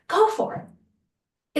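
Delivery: high, slow and drawn out; encouraging. Acted. Host urging listeners to take the leap, drawn-out cheer. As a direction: stretch it like a cheer.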